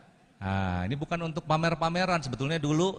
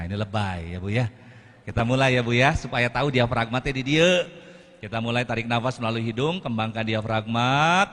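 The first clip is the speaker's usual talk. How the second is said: ordinary talk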